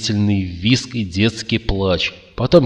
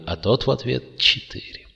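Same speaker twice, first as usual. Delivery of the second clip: plain reading voice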